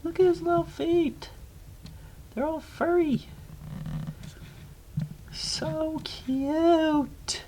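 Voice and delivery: cutesy cooing. Real, not acted. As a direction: coo in a tiny, very high voice. Cooing over a little animal.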